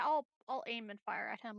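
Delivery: usual speaking voice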